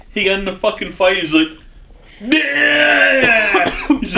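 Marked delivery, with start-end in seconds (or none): 2.2-3.7 retold yell. Retold fight, friend yells.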